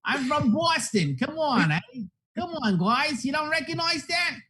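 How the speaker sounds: put-on high, slow, hammy Boston accent; overdone Boston accent drifting toward Irish. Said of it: "it's turning into Irish or something".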